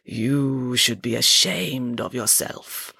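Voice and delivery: slow, low, resonant scolding; Madame Zandra. A narrator's reading. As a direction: intone the reproach grandly, stretching each word. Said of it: "boomed Madame Zandra in her beautiful resonant voice".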